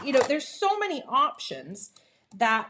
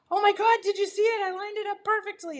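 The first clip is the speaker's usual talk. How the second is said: high, fast, thrilled squeal; giddy self-congratulation; excited; 8 semitones higher, faster than usual. Retold or imitated. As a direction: show off your win to the room. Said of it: "I kinda look around and I'm like".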